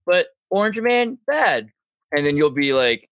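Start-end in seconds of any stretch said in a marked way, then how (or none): 0.0-1.7 high-pitched mock objection; mocking quote. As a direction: parrot a simplistic complaint, pitched way up. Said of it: "he'll say something which is just like".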